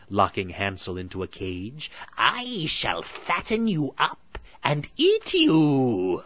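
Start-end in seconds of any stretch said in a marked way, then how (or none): 2.2-6.2 high, very slow, loud sneer; a hag judging her prey; witch voice. A narrator's reading. Witch inspects caged Hansel, slow and sneering. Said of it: "said the witch".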